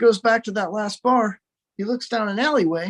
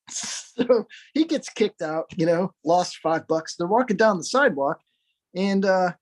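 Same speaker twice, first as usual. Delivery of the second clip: ordinary delivery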